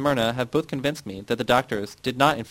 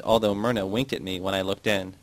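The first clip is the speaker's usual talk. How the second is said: ordinary delivery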